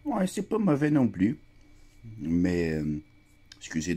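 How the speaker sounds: talking between bites; chewing while speaking; mouth partly full. Real, not acted. Seen 1: lips pressed, jaw working between words; food seen near mouth in R3.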